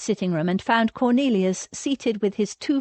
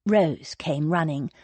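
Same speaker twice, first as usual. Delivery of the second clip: ordinary delivery, nothing marked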